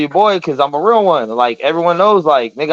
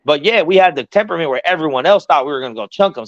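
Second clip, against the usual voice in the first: ordinary talk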